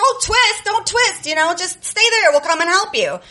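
high, sing-song fake helpfulness; mocking cousins. Cousins tease trapped little cousin, high mock reassurance. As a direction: tease her with false comfort.

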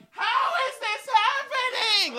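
high, thin mocking wail. Imitating women screaming at the wall, mock wailing. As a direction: ham up a despairing cry at the wall.

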